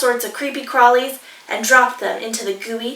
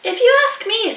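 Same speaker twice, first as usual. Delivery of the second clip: much higher, louder busybody platypus; 11 semitones higher, 4 dB louder, faster than usual. Acted. Platypus butting in on dingo's stew, high and loud. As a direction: pipe up like a know-it-all.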